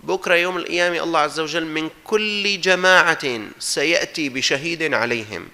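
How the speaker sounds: normal talking voice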